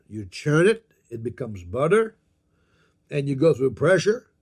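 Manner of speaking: ordinary talk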